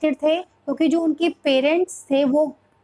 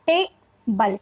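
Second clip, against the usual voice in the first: Table as टे, gap, बल; broken into syllables; 4 semitones lower, much slower than usual. Shown as a demonstration. Teacher showing how to break words, table split. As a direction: split table into two separate beats. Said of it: "तो हमें तोड़ना है".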